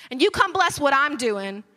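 usual speaking voice

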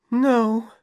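a high, miserable refusal; a girl saying no in misery; Dorothy miserably refusing the pills. A narrator's reading. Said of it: "Dorothy said miserably".

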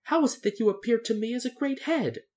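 high, puzzled question; baffled, wanting an explanation; bewildered. A narrator's reading. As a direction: ask in confusion, pitched well up. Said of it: "said Dorothy in bewilderment".

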